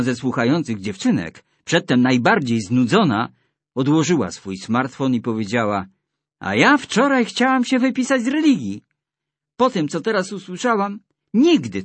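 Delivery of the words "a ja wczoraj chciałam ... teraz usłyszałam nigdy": high girlish voice, lightly voiced; little girl voice. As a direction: speak like a young girl. Girl's voice in retold story.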